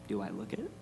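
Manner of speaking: soft, low reassurance; gentle. A narrator's reading. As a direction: reassure her softly. Aslan gently reassures Susan.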